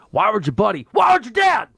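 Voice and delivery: shouted movie quote, high and punchy; movie-line impression. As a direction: belt it out like a film line. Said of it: "I'm gonna give you a movie line".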